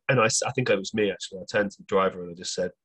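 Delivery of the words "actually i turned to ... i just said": normal talking voice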